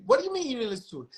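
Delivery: high, fast, incredulous challenge; retold angry demand. Boss snapping at his execs over the phone, incredulous and fast. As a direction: fire it off in disbelief.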